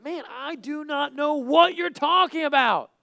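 high, loud, drawn-out protest; slow, mounting acted outburst; emphatic angry denial. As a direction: stretch every word into an exasperated shout. Acted Peter's final, emphatic denial.

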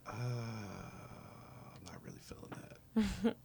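whispered, drawn-out mock hesitation; very soft, slow reluctant murmur; imitated reluctance. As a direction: balk slowly under your breath, imitating a reluctant rapper. Imitating reluctant rapper's reply.

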